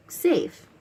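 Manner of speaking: normal talking voice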